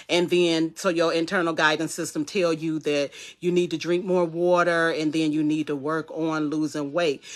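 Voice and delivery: usual speaking voice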